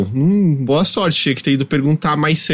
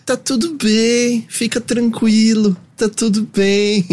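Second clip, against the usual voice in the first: slow, higher, soothing calm-down voice; soothing gate agent; 7 semitones higher, much slower than usual. Retold or imitated. Gate agent calming the frantic traveller, slow and higher.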